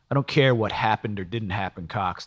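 plain reading voice

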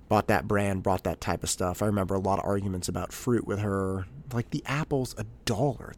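ordinary delivery

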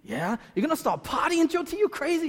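rising, pitch climbing to incredulous; a pal who can't believe it; friend's disbelief. Retold or imitated. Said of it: "He's like".

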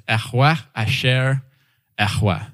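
"Yahweh Asher Yahweh" with throaty, spitty h sounds; Hebrew pronunciation. Shown as a demonstration. God answering Moses in Hebrew, breathy "Yahweh Asher Yahweh". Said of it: "more breathy and spitty than I can even do it".

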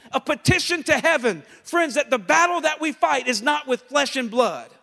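loud, high, ringing preacher's cry; full-force preaching. Acted. Pastor rallying his congregation, full-force preaching. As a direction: preach it out to the whole room. Preaching about the Appeal to Heaven flag.

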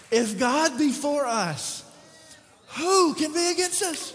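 slow and loud, pitch climbing on who; loud proclaiming. Shown as a demonstration. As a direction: proclaim it to the room. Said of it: "I want us to read that together".

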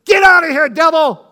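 loud shout at the devil; mock shout. Shouting at the devil to get out. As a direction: bellow it out to drive the devil off. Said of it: "I didn't start shouting and saying".

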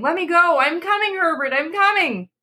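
very high, yearning calls; desperate calling. A narrator's reading. Mother calling to her son behind the door, very high desperate calls. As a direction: reach out to her son with your voice. Wife breaking free to son.

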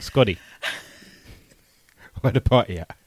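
husky imitation breaking into laughter; lightly voiced rapper, giggling between phrases; mock rapper voice. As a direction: play it for laughs, cracking up. S1 copies the [name] voice.

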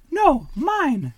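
very high little duck voice, possessive; proud duckling. A narrator's reading. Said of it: "said Baby".

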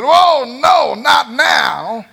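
high, drawn-out mock wail; demon's dismay. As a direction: cry out in hammed-up dread. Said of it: "That demon said".

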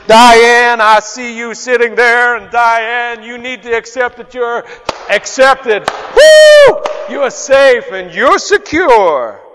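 loud, high, theatrical shouting prophecy with a whoop; mock hype preacher. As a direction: overdo it like a showman preacher. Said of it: "there was no".

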